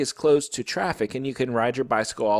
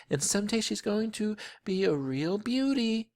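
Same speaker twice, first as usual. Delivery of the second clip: admiring mother; 9 semitones higher, 3 dB quieter than usual. A narrator's reading. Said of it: "my mother says".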